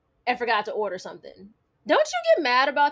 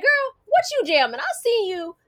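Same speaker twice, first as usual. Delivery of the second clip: higher, bubbly office coworker teasing; 7 semitones higher, 3 dB louder than usual. Retold or imitated. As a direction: imitate the coworker catching her dancing. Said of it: "She was like".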